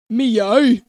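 loud Scottish meow. Acted. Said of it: "That's Scottish".

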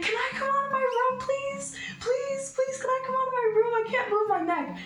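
high-pitched imitation of desperate screaming; re-enacted cry for help down the hall; retold tearful plea. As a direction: plead very high, without real volume. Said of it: "I'm crying, and I'm screaming down the hall".

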